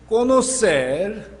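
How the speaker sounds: conocer slow, loud and rising; stress on conocer. Shown as a demonstration. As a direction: lean hard on conocer, stretch it out. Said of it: "se enfatiza esa palabra, conocer".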